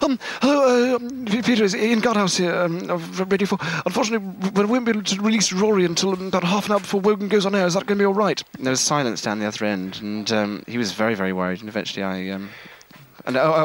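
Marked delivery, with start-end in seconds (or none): 0.0-8.4 high, polite radio producer on the phone; prank caller voice. Retold or imitated. Caller fooling Peter on the phone, high polite producer voice. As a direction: put on a high, proper voice to fool Peter. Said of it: "So I rang up Peter in Scotland and said".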